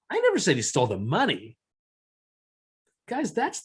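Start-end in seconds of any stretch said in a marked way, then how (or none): 0.1-1.6 money stressed, falling. Shown as a demonstration. Teacher to class, stressing money. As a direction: land on money. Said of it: "based on how I accentuated that".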